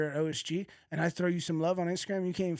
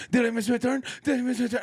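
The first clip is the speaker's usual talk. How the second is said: higher, louder anxious whine; hammed-up nervous competitor; mock panicky athlete; 9 semitones higher, 6 dB louder, faster than usual. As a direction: imitate a lifter fretting he missed his turn. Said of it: "he kept going up, and, like".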